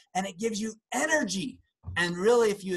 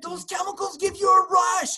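excited; 11 semitones higher than usual. Real, not acted. Excited emphatic explanation.

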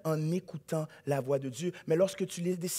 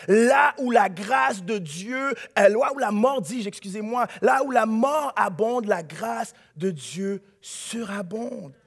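slow, louder proclamation; shouted repeat; 4 dB louder, slower than usual. Shown as a demonstration. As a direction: drive it home with force. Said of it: "il faut que je le répète parce que tu le crois pas". (proclamé fort et lentement)

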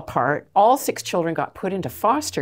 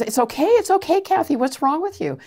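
put-on high, louder soothing girl's voice; comforting girl; 11 semitones higher, 3 dB louder than usual. Girl in the taxi comforting Kathy, high and soothing. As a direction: reassure her like a worried young girl. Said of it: "she goes".

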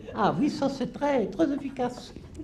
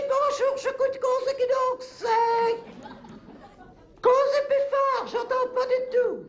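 shouting down the phone; 6 semitones higher than usual. Acted. Old woman hard of hearing shouts into the phone. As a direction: yell into the receiver to be heard. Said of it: "criez pas comme ça".

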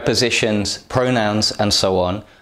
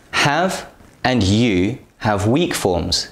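ordinary talk, nothing marked

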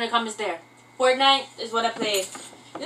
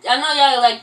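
usual speaking voice

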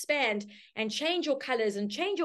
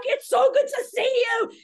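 much higher and louder, over-the-top delight; gushing Jane; 8 dB louder than usual. Retold or imitated. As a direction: squeal it like she's thrilled to see you. Jane overjoyed, hugs you.

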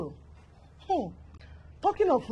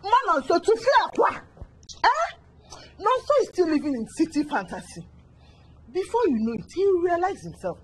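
higher, dismissive; scoffing reassurance; 9 semitones higher than usual. Acted. Friend dismissing the mother's worry, high scoffing. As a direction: brush off her fear.

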